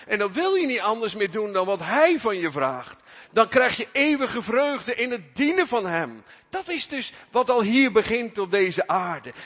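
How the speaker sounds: loud, high, fervent sermon voice; full-force preaching. Acted. Preacher urging the congregation toward joyful obedience, loud fervent preaching. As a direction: preach it out to the whole church.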